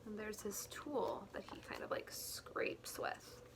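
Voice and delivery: whisper. Acted.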